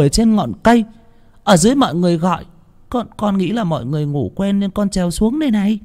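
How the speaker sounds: put-on high, even little boy telling what happened; calm child voice. A narrator's reading. Boy calmly answers the scolding villagers, high child voice. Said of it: "nó thản nhiên đáp".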